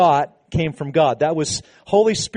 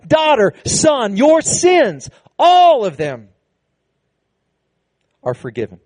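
tender pronouncement; 4 semitones higher, 4 dB louder, slower than usual. Acted. Jesus speaking forgiveness to hearts.

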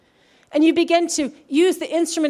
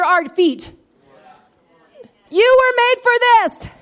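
fervent pulpit climax, louder and higher; full-force preaching; 6 semitones higher, 4 dB louder than usual. Acted. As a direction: preach it out to the whole church.

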